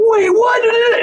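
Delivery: high-pitched shouted disbelief; hammed-up, squealed double take; mock shock. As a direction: imitate someone shrieking in bafflement. Imitates shocked person in argument.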